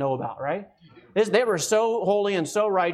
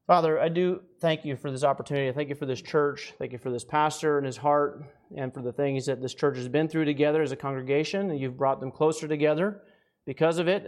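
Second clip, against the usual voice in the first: prayer; 4 semitones lower than usual. Acted. Word of prayer.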